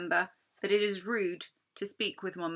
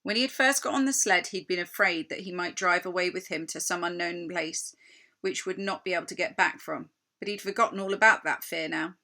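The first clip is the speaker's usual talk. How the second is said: plain reading voice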